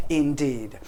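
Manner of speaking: normal talking voice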